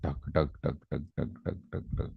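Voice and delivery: very soft, low rhythmic thumps; imitated heartbeat. Shown as a demonstration. Teacher shows students a tense heart, soft low thudding. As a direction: softly voice a steady thudding pulse. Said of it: "close your eyes and enjoy that".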